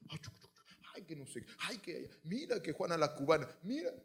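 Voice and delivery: lightly voiced hushed gossip, chuc chuc whispered; a hint of mothers gossiping at the school gate. Mothers at the school gate, hushed gossip.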